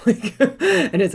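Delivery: laughing loudly mid-sentence. Real, not acted. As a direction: break into giggles.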